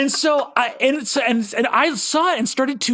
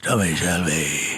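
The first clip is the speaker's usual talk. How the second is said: much lower, slow Birmingham rumble of Tommy Shelby; 3 dB quieter, slower than usual. Retold or imitated. Redoing the impression on request, deep Birmingham accent. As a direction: drop way down and drag the name out, Birmingham style. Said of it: "Go ahead".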